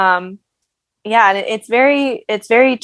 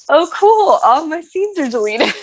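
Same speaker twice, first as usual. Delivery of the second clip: sarcastic cool; 7 semitones higher than usual. Retold or imitated. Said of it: "and I'm like".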